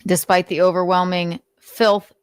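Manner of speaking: normal talking voice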